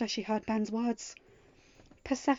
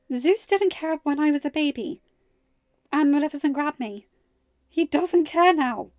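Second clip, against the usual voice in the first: higher, louder, bitter daughter; 6 semitones higher, 11 dB louder than usual. Acted. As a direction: spit out the old hurt, slowing at the end. Mal bitter about her father.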